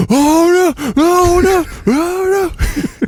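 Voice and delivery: very high, loud mock panic; overreacting panic. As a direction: shriek it like a terrified moviegoer. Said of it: "this guy next to me was like".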